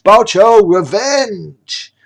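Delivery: screaming revenge, high; furious shout. Acted. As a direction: howl it with rage.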